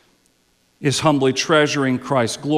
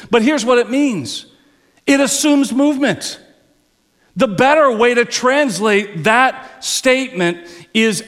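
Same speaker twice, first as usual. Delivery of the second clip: higher, driving preacher's cadence; full-force preaching; 7 semitones higher, 3 dB louder than usual. Acted. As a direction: preach it out to the congregation. Preaching on the Greek verb.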